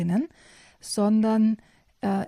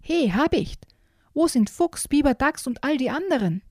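pitched-up call across the forest; 6 semitones higher than usual. Acted. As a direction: bear calling up high to the hawk. Bear calls up to the hawk.

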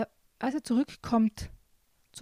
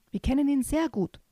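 higher-pitched witch's call, lightly played; 6 semitones higher than usual. A narrator's reading. Cattle witches answering about the intruder, calling out. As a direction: call it out, topping the last voice. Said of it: "riefen die Viehhexen".

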